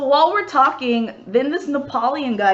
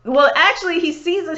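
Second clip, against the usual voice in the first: normal talking voice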